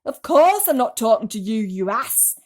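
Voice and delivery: loud irritated snap; grumpy centipede. A narrator's reading. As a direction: brush him off, annoyed. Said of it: "the centipede answered".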